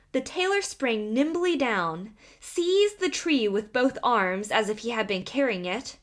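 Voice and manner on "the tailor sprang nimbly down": ordinary delivery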